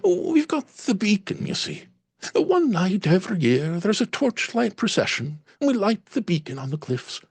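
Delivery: high, proud Irish lilt; Irish landlady voice. Acted. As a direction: tell of the beacon in an Irish accent. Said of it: "slight Irish lilt".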